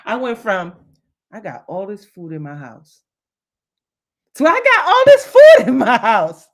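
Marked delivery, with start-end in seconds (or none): 1.3-3.0 low, quiet, deflated; glum. Shown as a demonstration. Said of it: "Same thing, different emotion". 4.5-6.5 high, loud, laughing with joy; delighted. Shown as a demonstration. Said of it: "Same thing, different emotion".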